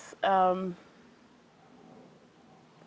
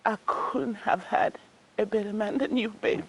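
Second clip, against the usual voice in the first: ordinary talk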